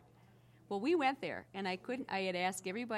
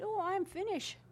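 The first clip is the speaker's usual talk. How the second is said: quoting the Finnish lady; 11 semitones higher, 4 dB louder than usual. Retold or imitated. Finnish woman quoted in story.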